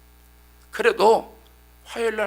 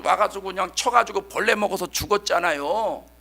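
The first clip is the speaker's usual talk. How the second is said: ordinary talk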